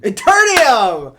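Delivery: loud, slow, high delight; excited exclamation. Real, not acted. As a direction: savor the word with glee.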